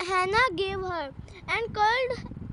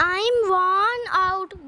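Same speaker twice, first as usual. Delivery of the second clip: groaning; 4 dB louder, slower than usual. Acted. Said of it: "groaned Dad".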